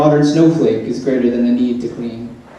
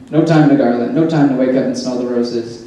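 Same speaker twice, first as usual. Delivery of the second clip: normal talking voice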